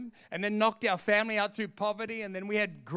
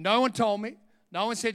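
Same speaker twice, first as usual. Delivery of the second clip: ordinary talk, nothing marked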